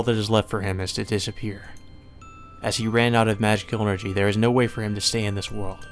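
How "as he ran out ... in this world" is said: ordinary delivery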